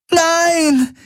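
very high, piercing scream. A narrator's reading. Kirai sees Gaurus shot dead, high scream. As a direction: scream as your friend falls dead. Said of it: "schrie Kirai".

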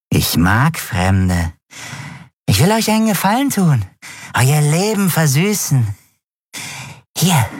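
high, youthful creep coaxing strangers; wheedling drug dealer. A narrator's reading. Dealer offers strangers a favour, high and wheedling. Dealer figure makes his pitch.

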